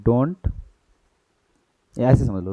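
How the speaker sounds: usual speaking voice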